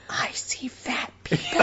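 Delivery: slow, hushed whisper; eerie slow whisper; creepy movie-parody whisper. Acted. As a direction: whisper it slowly like a spooky confession. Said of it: "looking at you sideways going".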